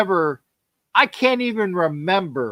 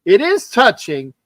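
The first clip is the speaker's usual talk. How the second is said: ordinary delivery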